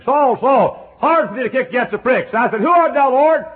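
high, loud voice from heaven and answering cry; dramatic cry. Retold or imitated. Preacher shouts the voice on the Damascus road. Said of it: "Heard a voice saying".

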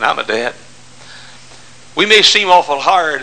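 ordinary delivery

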